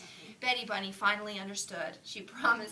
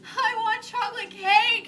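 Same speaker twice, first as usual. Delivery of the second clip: put-on high, loud, slow wailing child; whiny crying wail; 14 semitones higher, 14 dB louder, slower than usual. Little bunny wailing to her teacher, loud crying wail. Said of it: "Betty Bunny started to cry".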